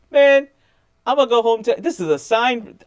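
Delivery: high, loud awestruck exclamation; amazed guard. Retold or imitated. Security guard awed after meeting him, high and loud. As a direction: marvel at the sign. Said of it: "the security guard goes".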